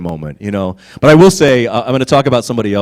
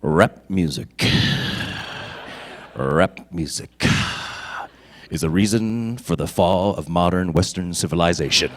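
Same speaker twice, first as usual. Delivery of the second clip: deep, slow, dead-serious pronouncement; Tim Russ; 8 semitones lower, 8 dB quieter, slower than usual. Retold or imitated. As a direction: intone it low and solemn, with long pauses. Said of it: "he's like this".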